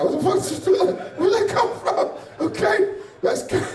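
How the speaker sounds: high voice dissolving into laughter; laughing retelling. Real, not acted. Comic quoting a friend's shocked reaction, laughing as they retell it. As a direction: crack up while quoting, pitch climbing.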